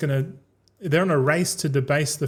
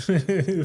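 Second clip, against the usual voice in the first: short, higher-pitched chuckle; amused chuckle; 4 semitones higher than usual. Real, not acted. Streamer joking about the big Dogecoin, amused chuckle. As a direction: chuckle at your own silly wordplay. Laughs at own Dogecoin wordplay.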